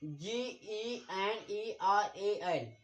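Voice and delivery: letters spelled slowly one by one; slow spelling out. Shown as a demonstration. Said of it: "इसे क्या पढ़ेंगे".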